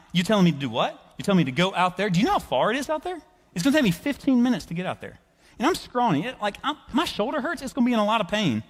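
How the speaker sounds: climbing, high-pitched comic complaint; protesting. Acted. Simon reasoning with Jesus about rowing out, protesting. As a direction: whine excuses with growing disbelief. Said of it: "I would start to reason with him".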